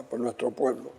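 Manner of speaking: ordinary delivery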